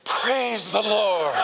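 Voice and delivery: slow, high relieved sigh of praise. Acted. Man stopped at the dam's rim, slow relieved praise. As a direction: breathe it out in relief.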